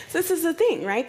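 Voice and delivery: ordinary talk, nothing marked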